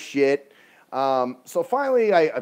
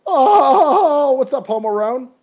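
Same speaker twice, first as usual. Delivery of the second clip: loud, high drawn-out holler; shouted greeting; 11 semitones higher, 11 dB louder, slower than usual. Real, not acted. Streamer hails a friend joining live, real shouted greeting. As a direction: holler a big rowdy hello.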